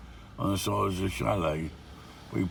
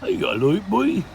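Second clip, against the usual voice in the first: high, loud put-on Norfolk accent; hammed-up Norfolk local, pitch climbing sharply on "boy"; silly local accent; 12 semitones higher, 5 dB louder than usual. Vlogger mimicking a local man, silly high Norfolk accent. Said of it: "You have to put that silly accent on it".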